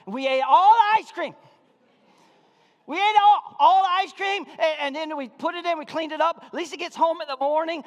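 loud, high-pitched preacher's shout; impassioned pulpit holler; full-force preaching. Real, not acted. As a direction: preach it at the top of your voice.

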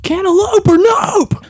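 high, loud, drawn-out slogan; catchphrase shout. Pretend. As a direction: belt it out like a silly slogan. Turning the remark into catchphrase.

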